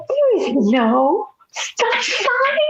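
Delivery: high, slow, tearful wail; panicked crying protest. Retold or imitated. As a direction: plead through tears, stretching every word. Retelling panicked reply to cop.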